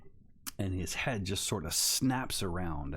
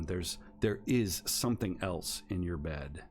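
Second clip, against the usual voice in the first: usual speaking voice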